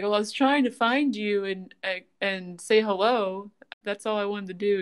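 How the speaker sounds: normal talking voice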